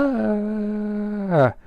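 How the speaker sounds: short high startled squeal; sudden squeal of alarm. Acted. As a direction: yelp as you're flung upward. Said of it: "interrupted herself with a squeal".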